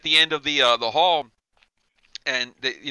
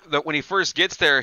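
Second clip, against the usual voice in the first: ordinary delivery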